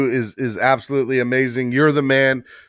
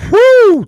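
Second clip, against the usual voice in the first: high, loud blown-out whew; a sharp, high whoop of relief; excited whew; 12 dB louder than usual. Real, not acted. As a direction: exhale big, overwhelmed with joy. Celebrating the donation after the call.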